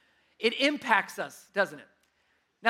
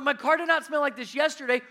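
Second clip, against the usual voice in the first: retold frustration; 6 semitones higher, 6 dB louder than usual.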